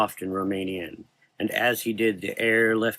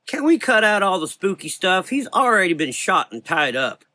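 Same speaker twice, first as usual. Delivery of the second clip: high, loud annoyed woman; exasperated woman; 10 semitones higher, 5 dB louder than usual. Acted. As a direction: sigh it out, fed up. Rose voiced, exasperated.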